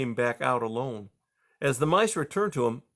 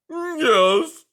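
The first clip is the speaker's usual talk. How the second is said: loud, high tearful cry; 10 dB louder than usual. A narrator's reading. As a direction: cry it out in anguish. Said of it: "cried Aerith".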